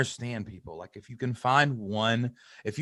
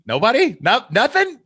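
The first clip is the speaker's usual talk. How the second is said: high, loud mock disbelief; 13 semitones higher, 8 dB louder than usual. Host mimics a creator after a failed launch, incredulous. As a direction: act stunned that nobody bought. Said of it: "and you're like".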